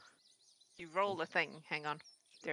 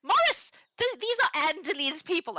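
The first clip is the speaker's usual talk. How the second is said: much higher and louder, gushing; overexcited old woman; 8 semitones higher, 4 dB louder than usual. Acted. Lonely farm wife greets the visitors, high and gushing. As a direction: squeal with delight at visitors. Gertrude calls to her husband.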